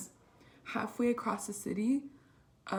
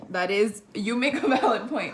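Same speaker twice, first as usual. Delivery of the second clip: louder, laughing through the words; 11 dB louder than usual. Real, not acted. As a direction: concede the point while cracking up. Laughing, conceding to roommate's joke.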